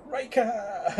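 high, drawn-out cry of the name; exclaimed note. Retold or imitated. Co-host reading out an excited exclamation from episode notes, high cry.

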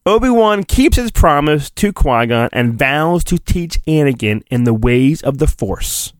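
ordinary delivery, nothing marked

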